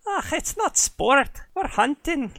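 Irish huntress. Acted. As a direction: correct him firmly in a thick Irish lilt. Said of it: "slipping into Irish now".

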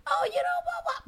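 very high, put-on squeak; mock squeal. Speaker re-enacting filming the DJ, high mock squeal. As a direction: jump way up into a squealed reaction. Acts own reaction while filming DJ.

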